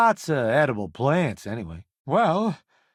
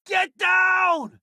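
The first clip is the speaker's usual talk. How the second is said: high shouted command to duck; urgent warning shout; 3 dB louder than usual. Acted. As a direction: bark it out to save your crew. Combat warning to the crew.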